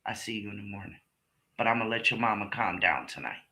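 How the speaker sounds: husband apologizing to his son in a low voice; imitating dad. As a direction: drop low and quiet like the husband backing off. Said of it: "And his father said".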